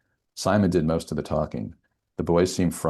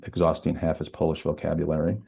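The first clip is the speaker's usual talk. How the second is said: usual speaking voice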